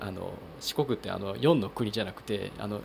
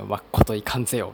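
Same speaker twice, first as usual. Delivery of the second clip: a louder, emphatic Tosa-dialect line; Tosa dialect phrase; 8 dB louder than usual. Retold or imitated. Podcaster showing listeners typical Tosa dialect, emphatic Tosa accent. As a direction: deliver it like a proud Kochi local, in Tosa dialect. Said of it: "土佐弁っていうと".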